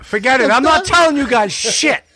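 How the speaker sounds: loud, high joking outburst; fake-furious yell at friends; mock angry shout. Joking outburst after being teased.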